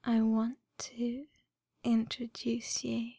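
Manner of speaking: ordinary delivery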